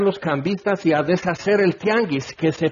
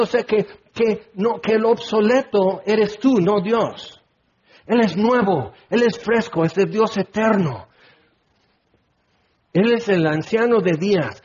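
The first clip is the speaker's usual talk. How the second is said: ordinary talk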